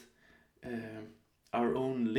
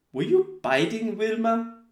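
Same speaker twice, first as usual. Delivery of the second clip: higher and louder, caught-out disbelief; surprised question; 9 semitones higher, 6 dB louder than usual. Retold or imitated. Mother asking son if he bit Vilma, mock surprised. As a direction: ask it like you just realised what happened. Girlfriend asks son, retold.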